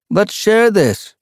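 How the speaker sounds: high, slow friendly call; calling out to share. A narrator's reading. Young tom calls to Leopard Paw, high and slow. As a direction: lift it up and out to a friend. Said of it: "he called".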